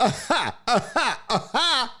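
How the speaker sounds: high, forced mocking cackle; put-on laughter in separate bursts; mock guffaw. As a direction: imitate a jeering crowd's guffaws. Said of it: "everyone laughed and guffawed".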